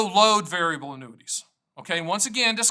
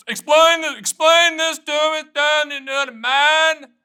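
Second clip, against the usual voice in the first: high, loud, drawn-out mimicked plea; mock pleading yell; 10 semitones higher, 7 dB louder, slower than usual. Stan mimics people begging him to explain, mock pleading yell. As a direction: wail it high and loud, stretching the words.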